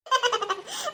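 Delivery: high, light laugh; soft high-pitched giggle. Acted. Neighbour amused by the gossip about the old man, laughing.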